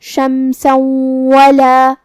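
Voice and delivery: شمسا ولا joined with nasal ghunna, very slow; idgham demo. Shown as a demonstration. Teacher showing idgham with ghunna, very slow. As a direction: merge the tanween of شمسا into ولا with a held nasal hum. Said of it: "ہم یہ حکم کو ایسے ادا کریں گے".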